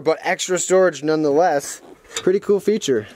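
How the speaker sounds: normal talking voice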